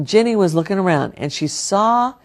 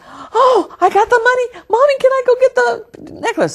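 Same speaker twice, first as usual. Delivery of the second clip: put-on very high, bright child voice bursting with joy; excited little girl; 4 dB louder than usual. Jenny telling her mommy she got the money, very high excited child voice. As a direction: squeal it like a thrilled five-year-old.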